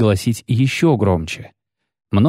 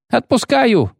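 higher, wailing cry of surrender; howl; slower than usual. A narrator's reading. Terrified poet gives in to the executioner, howling. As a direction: howl it in panic. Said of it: "взвыл Лютик".

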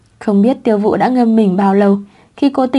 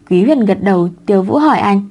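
ordinary delivery

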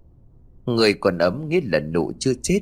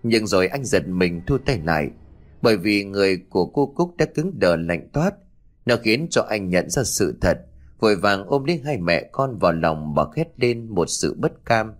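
ordinary delivery, nothing marked

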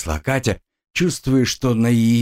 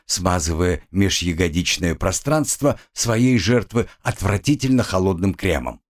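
plain reading voice

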